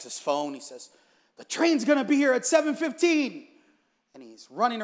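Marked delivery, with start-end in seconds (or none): 1.4-3.5 high, loud frantic blurt; urgent, raised, panicked cry; frantic grandson. Acted. Grandson checking his phone before school, frantic.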